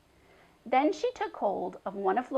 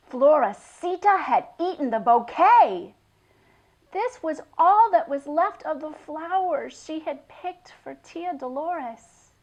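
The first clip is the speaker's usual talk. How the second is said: dramatic reveal; 6 semitones higher, 9 dB louder than usual. A narrator's reading. Narrator reveals the disaster.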